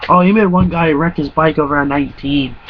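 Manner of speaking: usual speaking voice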